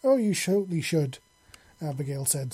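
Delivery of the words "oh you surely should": playful, louder sly interjection; teasing. Acted. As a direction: chime in with mischief. Abigail cuts in teasingly.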